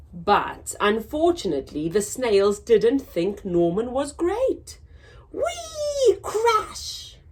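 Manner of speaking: lively storybook reading, big swoops in pitch; reading to children. A narrator's reading. Teacher reads to children, bright storybook voice. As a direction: read it out to little listeners. Reads picture book aloud.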